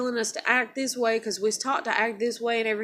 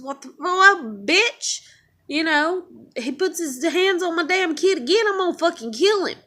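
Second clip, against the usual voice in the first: higher, louder threat in rage; real furious yell; 7 semitones higher, 5 dB louder than usual. Real, not acted. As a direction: threaten the bully in raw anger. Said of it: "that's how mad it makes me".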